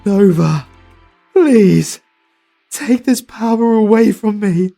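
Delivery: ordinary talk, nothing marked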